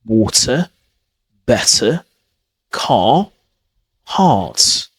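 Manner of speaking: "Water, better, car, heart" one by one, no r sounded; British model pronunciation. Shown as a demonstration. Teacher models words for learners to repeat, British pronunciation. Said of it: "Repeat after me and pause it".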